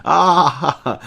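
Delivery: high loud ah of laughter; delighted roar. A narrator's reading. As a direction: let out a huge joyful ah. Remy roaring with laughter.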